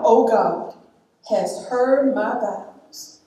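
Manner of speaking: low, measured reading of a psalm; hushed solemn psalm reading; scripture reading. Acted. Reads a psalm at a funeral.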